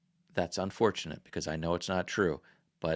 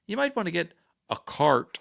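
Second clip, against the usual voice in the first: mild mock-British, pitched higher; lightly imitated British accent; 8 semitones higher than usual. British employee corrects himself for an American customer, light imitated British accent. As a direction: raise the voice into a slight British imitation. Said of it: "British accent".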